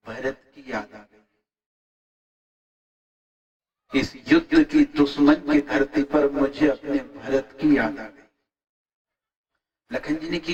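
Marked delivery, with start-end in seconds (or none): 0.1-8.2 wistful longing. Acted. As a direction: let the words sink, hushed with longing. Lakhan misses brother Bharat.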